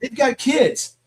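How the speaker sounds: high-pitched indignant comeback; exasperated retort. Acted. Reader talking back to the lecturing teen, exasperated retort. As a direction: argue back in exasperated disbelief.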